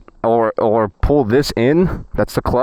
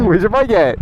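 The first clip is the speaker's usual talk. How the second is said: teasing; 9 semitones higher than usual. Pretend. Teasing Eve on the street.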